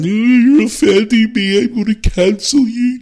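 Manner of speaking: high, mocking voice of an offended critic; mock them in a high put-on whine; mocking whiny snowflake. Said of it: "old wee woke dopes coming in every week saying".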